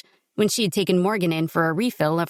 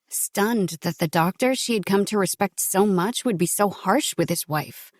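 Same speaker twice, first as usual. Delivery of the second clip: ordinary delivery, nothing marked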